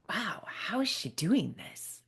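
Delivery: retold thought in a hushed, amazed whisper; awed whisper. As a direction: whisper it like a private thought of wonder. Retold amazed inner reaction at talk.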